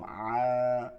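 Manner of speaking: "আ" long, low and squeezed in the throat; low drawn-out thin "আ"; soft pressed ain. Shown as a demonstration. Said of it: "নরম".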